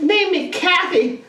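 put-on high girly demand, stretched out; mock girl voice. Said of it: "She's like".

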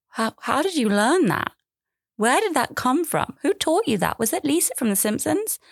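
high, disbelieving questions; incredulous high voice. Retold or imitated. Mother quizzing her kids on rude talk, high and incredulous. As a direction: pitch up in mock outrage. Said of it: "I've been like".

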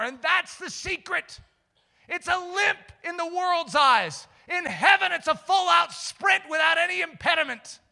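high, loud, driving sermon voice; impassioned preaching. Real, not acted. Preacher warning the congregation about sin, impassioned. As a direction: press the warning home with force.

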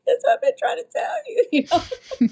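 high and fast, half crying; crying-laughing. Retold or imitated. Daughter to mom, crying and laughing, high and fast. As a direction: blurt it out through happy tears. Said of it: "I'm crying".

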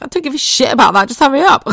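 high, loud, rushed mock yell; comically desperate shout, racing and rising; exasperated retold outburst. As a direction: act out snapping at the doctor in agony. Retold outburst at doctor during pain.

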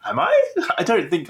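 shooting way up in pitch, then a laugh; incredulous Am I, laughing it off; high squeak of surprise, then laughter; surprised disbelief. Retold or imitated. Disbelief at compliment.

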